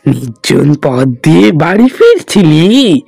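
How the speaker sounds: a ghost toying with its prey; menacing ghost. Acted. Ghost taunts old Ganesh on the lonely road, low and menacing.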